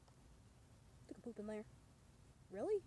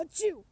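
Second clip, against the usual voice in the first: fake sneeze; 3 semitones higher, 6 dB louder than usual. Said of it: "I can't talk".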